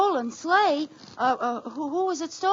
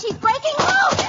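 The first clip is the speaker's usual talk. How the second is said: alarmed shout; 8 semitones higher than usual. Acted. Horse breaking loose.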